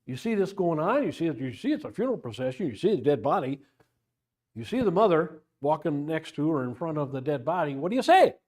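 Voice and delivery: usual speaking voice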